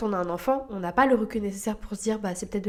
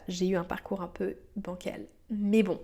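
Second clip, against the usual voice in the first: ordinary talk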